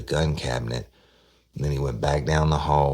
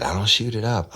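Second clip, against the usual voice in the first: higher, louder protest; insistent denial; 9 semitones higher, 4 dB louder than usual. Retold or imitated. Woman retelling how she protested to the host, raised insistent denial. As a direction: push back hard, mock offended. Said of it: "She said".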